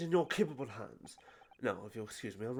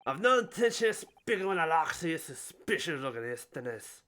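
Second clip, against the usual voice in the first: put-on Scottish accent. Governor introducing himself, in a hammed-up Scottish accent. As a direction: boom it in a stage Scottish accent. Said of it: "Oh, he is Scottish?".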